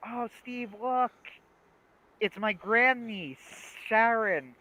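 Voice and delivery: put-on high, drawn-out cooing over a baby; mock cooing delight. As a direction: gush slowly, stretching the name. Voices doting character in retold sketch.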